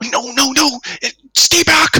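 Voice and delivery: high-pitched frantic warning; panicked stranger. Acted. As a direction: cry out high, urging them away. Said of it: "And you all hear at this point".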